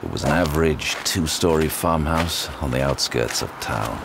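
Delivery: level detective narration; story narration. Acted. Sinclair narrates arriving at the farmhouse, flat detective narration. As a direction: lay out the scene matter-of-factly.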